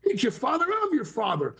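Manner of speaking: high, insistent jealous dad; possessive father. Pretend. Father telling his son who his real dad is, high and possessive. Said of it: "You sit there and go".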